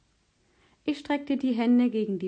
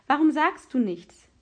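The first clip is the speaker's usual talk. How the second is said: higher, louder demanding question; a boy's impatient, raised-voice challenge; impatient outburst; 4 semitones higher, 5 dB louder than usual. A narrator's reading. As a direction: burst out, pushing for a reply. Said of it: "platzte er los".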